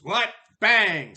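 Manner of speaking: loud, high explosive burst; big goat's shout. Acted. Biggest billy goat smacking the troll, sudden loud shout.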